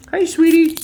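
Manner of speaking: very high, loud sing-song greeting. Real, not acted. Owner greeting his cat at his feet in baby talk.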